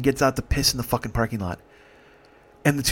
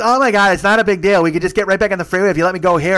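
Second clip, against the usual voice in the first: loud, high imitation of a pushy passenger; worked-up retold protest; impatient pleading; 6 semitones higher, 6 dB louder than usual. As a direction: plead loudly, pitched up. Ron argues to be let out.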